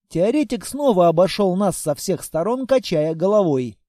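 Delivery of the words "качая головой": usual speaking voice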